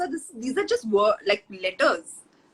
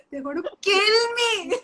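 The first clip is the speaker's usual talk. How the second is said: laughing; 8 semitones higher, slower than usual. Real, not acted. Laughing outburst after attempt.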